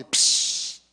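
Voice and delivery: sharp hissed "pss" of escaping gas; imitated fizz burst. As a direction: imitate a shaken soda bursting open. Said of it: "Los agitaba así". (siseo de gaseosa agitada)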